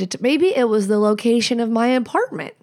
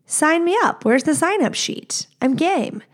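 eager; 7 semitones higher than usual. Retold or imitated. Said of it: "men who would be like".

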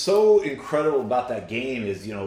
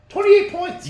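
loud, very high-pitched disbelief; incredulous; 7 dB louder than usual. Real, not acted. Co-host repeating the average winning margin, incredulous.

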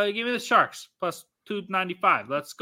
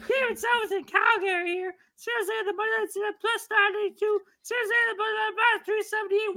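squeaky announcer voice. Pretend. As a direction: squeal out the betting lines like a cartoon host. Drunk put-on announcer bit.

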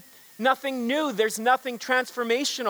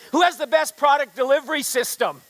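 higher and louder, building the crowd; bigger rally shout; 6 semitones higher, 5 dB louder than usual. Acted. President at the convention, pushing the crowd harder, excited shout. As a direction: push the excitement up a notch. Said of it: "A little more excited on this next one".

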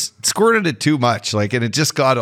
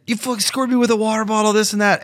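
mock furious rant; 8 semitones higher, faster than usual. Quoting the opponent losing it.

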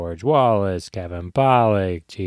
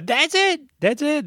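leftover Gottfried squawk. Acted. Still in the screechy joke voice.

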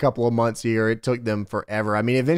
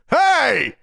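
high, sharp Hey shouted out; mock Hogan yell; 12 semitones higher, 3 dB louder than usual. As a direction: burst in calling out Hey. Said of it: "Hogan runs in the room and goes".

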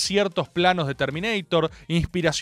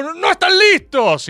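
high, loud mock outburst; outraged retort; 12 semitones higher, 7 dB louder than usual. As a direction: imitate a thwarted visionary yelling in protest. Acts out Jodorowsky's outraged reply.

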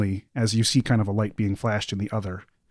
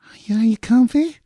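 high, soft, coaxing creature voice; 13 semitones higher, 3 dB louder than usual. Acted. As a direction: coo it gently like a too-sweet nurse. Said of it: "a very soft voice".

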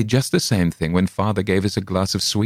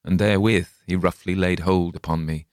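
usual speaking voice

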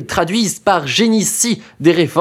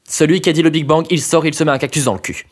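fast aside; much faster than usual. Acted. Rapid throwaway aside.

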